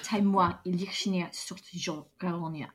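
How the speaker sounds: put-on mangled Russian with a faint accent; badly faked Russian accent; attempted Russian accent. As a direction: fumble through Russian in a mock accent. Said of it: "I can't do a Russian accent".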